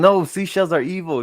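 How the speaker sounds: spooky overdone warning voice; mock scary warning. Friend suggesting a dad frighten his son, spooky. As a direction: scare the kid off seashells. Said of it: "You gotta tell him".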